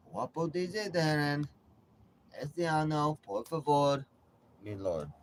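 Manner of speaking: a joking bit of broken Spanish; mock Spanish. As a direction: play to the Spanish-speaking audience. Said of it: "That's for my Spanish speaking audience".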